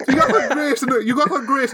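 laugh-cry mocking repeat; mock heckle, laughing.

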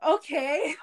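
the aunt caught off guard; imitating aunt. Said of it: "and, like".

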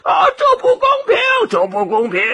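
high, fast cries of outrage; hammed-up squawk of a protesting crowd; shouted protest. Animals object to the ranking, shouting high and quick. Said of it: "都喊起来了".

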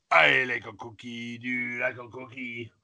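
low gruff Cookie Monster growl. Acted. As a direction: growl it like the hungry blue monster. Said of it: "Cookie Monster".

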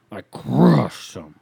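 slow, loud proud brag about the drinks; slow loud boast. Shown as a demonstration. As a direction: drawl out the brag with gusto. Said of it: "I crushed those".

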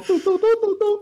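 high sung doo-doo imitating a phone ringing; mock ringtone. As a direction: hum the ring tone way up high.

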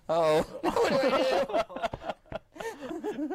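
high, slow laughing panic. Retold or imitated. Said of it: "and I'm like".

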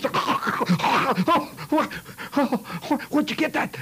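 put-on high, stunned boy's voice; shocked kid. Tough kid stunned by the finger, high and shaken.

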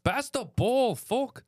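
high Irish yell; Irish fan accent. Retold or imitated. Irish fan yelling at the Knicks, high Irish accent. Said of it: "he kept saying".

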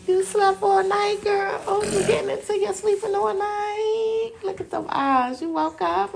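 put-on high, sing-song cooing to a baby; baby talk. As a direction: coo up high at the little one.

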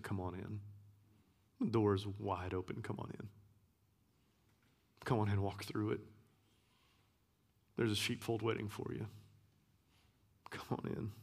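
soft, hushed welcome from a loving father; tender invitation. Acted. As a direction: coax them gently through the open door.

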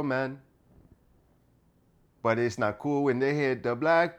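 mock Jamaican lilt; Jamaican accent. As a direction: try out a put-on Jamaican accent. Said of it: "what would a Jamaican sound like?".

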